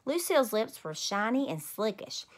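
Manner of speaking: plain reading voice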